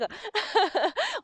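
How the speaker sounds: quick high giggle; a light embarrassed laugh; short laugh. Real, not acted. Laughs after thanking David.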